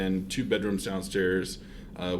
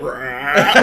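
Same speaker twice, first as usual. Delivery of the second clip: mock demon growl; 6 semitones higher, 12 dB louder than usual. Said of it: "it's like".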